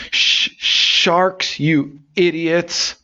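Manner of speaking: very high, slow, cracking stammer; slow high squeaky stammer at the punchline; stuttering exasperation. Acted. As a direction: finally get the word out, fed up. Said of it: "Pete goes".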